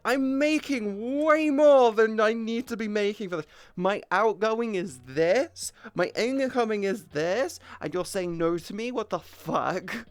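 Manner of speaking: high, loud, incredulous retort; venting at the bank over a denied card; exasperated rant. Retold or imitated. Indignant outburst at the bank staff. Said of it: "I'm like".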